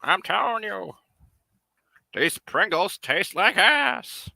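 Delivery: high, slow, loud cartoon seagull; insistent seagull, high and slow. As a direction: play a stubborn bird insisting. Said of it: "he'll be like".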